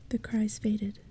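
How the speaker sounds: ordinary delivery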